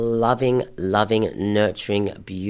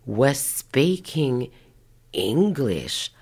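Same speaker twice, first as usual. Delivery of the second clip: very slow, stretched words with big pitch swoops; dumbfounded disbelief; 4 semitones higher, much slower than usual. Retold or imitated. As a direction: spell it out incredulously, word by word. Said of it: "with, like, a shocked, dumbfounded".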